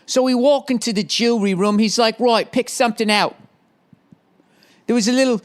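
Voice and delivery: high-pitched, hammed-up English accent. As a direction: put on a silly high English voice. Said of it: "why she has an English accent".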